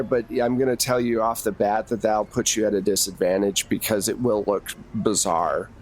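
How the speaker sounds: normal talking voice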